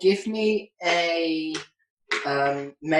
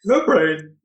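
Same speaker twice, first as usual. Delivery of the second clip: loud, higher dumb zombie voice; zombie moan; 4 semitones higher, 8 dB louder than usual. Acted. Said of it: "GM voicing zombie Bobby".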